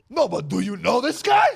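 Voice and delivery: high, loud bragging dad; proud dad shout. Retold or imitated. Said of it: "and he goes".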